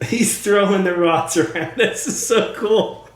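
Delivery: giggling, pitch jumping up with excitement; laughing with delight. Real, not acted. Talking to Merlin's rods, laughing in real excitement. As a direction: laugh through it, thrilled by what you see. Spirit rods moving, thrilled.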